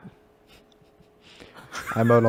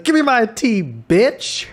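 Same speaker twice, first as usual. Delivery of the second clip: raised, hammed-up demand; YouTuber imitation; 9 semitones higher, much slower than usual. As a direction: demand it in a mock character voice. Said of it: "And he's like".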